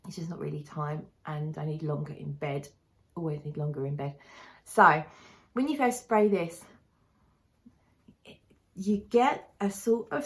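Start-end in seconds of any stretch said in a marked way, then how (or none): 0.1-4.1 soft tired whisper. Real, not acted. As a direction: breathe it out, half asleep. Tired grumble about needing sleep.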